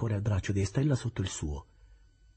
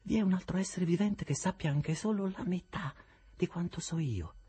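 lightly voiced, never raised young woman; measured, unyielding calm; calm Estella; 12 semitones higher than usual. Estella answers her adoptive mother without raising her voice. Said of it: "senza mai alzare la voce".

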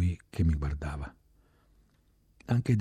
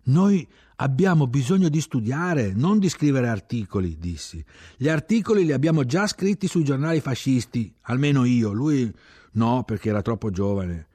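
loud, insistent argument; passionate protest; 7 semitones higher, 8 dB louder than usual. Acted. Young man insists to the chief they need to study, loud and heated. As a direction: argue your case with rising heat.

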